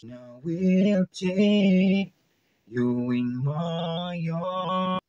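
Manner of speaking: slow, high auto-tuned singing; auto-tune voice. Shown as a demonstration. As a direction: sing it through heavy auto-tune. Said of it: "ito naman yung ating auto-tunes".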